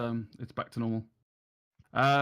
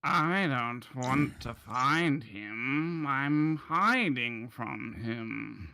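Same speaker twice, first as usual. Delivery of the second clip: very slow, stretched-out protest; reluctant shopkeeper; 2 semitones higher, much slower than usual. Acted. Shopkeeper refusing to find the man he hides from, slow and reluctant. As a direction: drag out each word, rising into the last question. Game master voices hiding shopkeeper.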